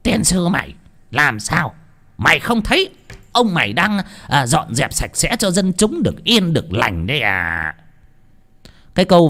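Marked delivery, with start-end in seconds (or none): 0.0-7.7 sharp, scornful snapping at the messenger; a tyrant annoyed at being interrupted; contemptuous retort; irritated chief. Acted.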